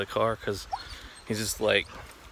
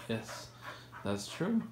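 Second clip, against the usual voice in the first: usual speaking voice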